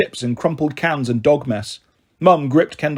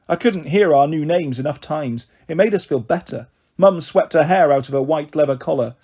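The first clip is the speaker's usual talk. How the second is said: ordinary delivery, nothing marked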